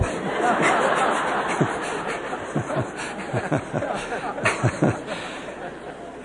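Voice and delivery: breathy, high, helpless laughter; real laughter. Real, not acted. Speaker laughing at the homesick elder's words, real laughter. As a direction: let the laugh run out of control. Laughs at his own story.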